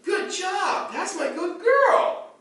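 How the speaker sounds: high, slow praise with a laugh; praising the dog. Shown as a demonstration. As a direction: gush over the dog.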